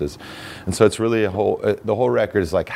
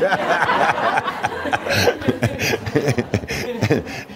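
high, long burst of laughter; real laughter; 9 semitones higher than usual. Real, not acted. Guest laughing at knees moving a quarter inch.

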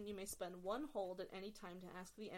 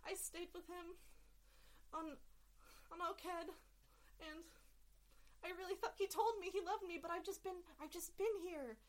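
higher, tearful confession; heartbroken Kedian; 10 semitones higher than usual. Acted. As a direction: pour out the betrayal, near tears. Sha'al confides, near tears.

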